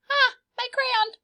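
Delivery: put-on the girl in the book cries out for her eaten crayon; dismayed little girl. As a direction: squeal it like a kid who just lost her crayon.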